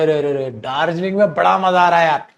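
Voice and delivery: usual speaking voice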